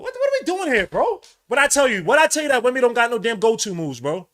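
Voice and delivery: angry fan ranting at the screen; heated rant. Real, not acted. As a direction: rub it in loudly. Fan ranting about Wemby.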